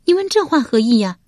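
high, put-on female voice, affronted; indignant high-pitched question; affronted noblewoman. A narrator's reading. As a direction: challenge him in a high lady's voice. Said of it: "文姜变脸说道".

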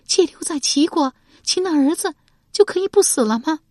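high, loud female character voice; young woman's voice. A narrator's reading. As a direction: lift into the concubine's voice.

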